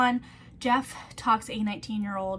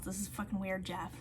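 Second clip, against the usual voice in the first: normal talking voice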